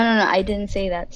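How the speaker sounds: ordinary talk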